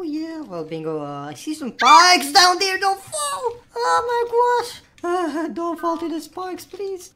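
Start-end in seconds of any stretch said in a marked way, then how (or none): 1.3-7.1 worried pleading. Pretend. Sister scared Bingo will fall.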